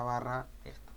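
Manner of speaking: ordinary talk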